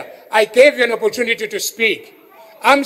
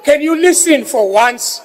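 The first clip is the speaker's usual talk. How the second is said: angry; 4 semitones higher, 4 dB louder than usual. Real, not acted. Exasperated demand to the chair.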